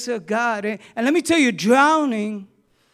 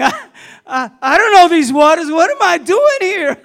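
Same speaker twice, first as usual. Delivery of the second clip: rising panic, louder and higher; panicking; 7 semitones higher, 11 dB louder than usual. Retold or imitated. A preacher reliving his panic far out at sea, loud and high. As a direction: let the panic climb as the voice jumps up.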